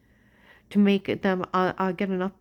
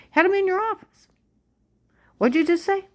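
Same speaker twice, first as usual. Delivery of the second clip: accusing outburst; 12 semitones higher, 6 dB louder than usual. Real, not acted. Accusing the agent.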